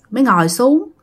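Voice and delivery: ordinary delivery, nothing marked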